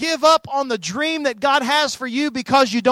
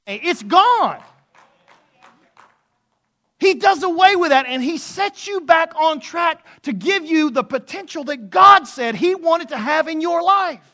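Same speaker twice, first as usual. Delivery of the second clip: rising, louder preaching voice climbing to a peak; full-force preaching; 6 semitones higher, 5 dB louder than usual. Acted. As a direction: preach it with mounting fire, louder and higher. Preacher building to climax.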